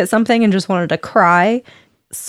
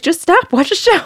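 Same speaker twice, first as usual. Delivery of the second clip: annoyed husband; 9 semitones higher, 3 dB louder than usual. Retold or imitated. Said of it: "And John's like".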